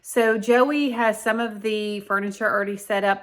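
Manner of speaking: ordinary delivery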